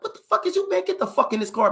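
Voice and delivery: very high, exasperated father's order; imitated irate dad. Imitating dad ordering truant son into the car, high and irate.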